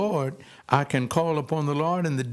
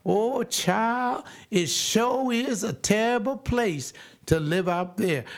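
high, put-on fretful elder; mock old person; 6 semitones higher, slower than usual. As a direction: lightly play a worried old granny.

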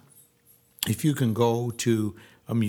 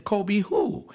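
a high, puzzled double take; higher, startled Kobe who; surprised question; 8 semitones higher than usual. Retold or imitated. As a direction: ask it like you can't believe the name. Disbelieving reply to friend.

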